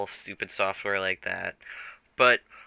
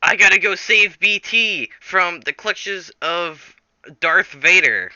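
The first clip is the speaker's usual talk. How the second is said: louder, higher, slow determined vow; heroic resolve; 8 semitones higher, 8 dB louder, slower than usual. Acted. Jack vows loudly to rescue BT. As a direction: declare the mission like a hero. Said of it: "He's like".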